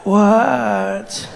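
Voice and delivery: long drawn-out tired response; weary annoyance. Acted. Dog-tired woman woken by her partner, drawn-out weary voice. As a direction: groan the word out, half asleep.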